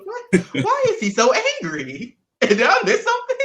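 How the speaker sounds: high, loud mock bewilderment, laughing through it; baffled reaction. Cast member reacting to a castmate's outburst, baffled and laughing. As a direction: act stunned and amused, pitch shooting up.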